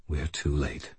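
low and quiet. A narrator's reading. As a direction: say it softly, defeated. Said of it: "Michael said quietly".

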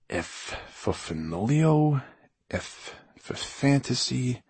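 slow, drawn-out proclamation, fading; grand announcement. A narrator's reading. Writer seals his song, slow self-important announcement. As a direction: savour each F slowly. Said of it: "he announced".

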